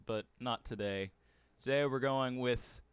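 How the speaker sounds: ordinary talk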